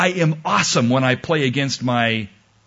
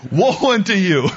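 loud, high prophet's warning; mock biblical prophet; 7 semitones higher, 3 dB louder than usual. Shown as a demonstration. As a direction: proclaim doom from the pulpit. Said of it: "I'm just illustrating".

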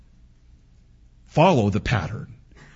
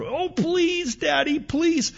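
put-on high, pleading child voice, softer; begging little girl; 3 dB quieter, slower than usual. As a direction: whine it up high like a small daughter. Daughter begging for pancakes.

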